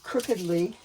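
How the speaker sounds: usual speaking voice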